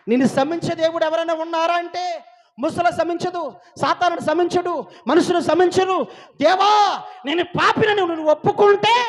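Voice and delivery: high, very loud pulpit shouting; impassioned preacher at full volume; full-force preaching. Acted. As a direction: build to a shouted cry to God.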